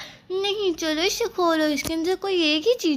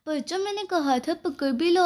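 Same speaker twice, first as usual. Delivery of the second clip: ordinary delivery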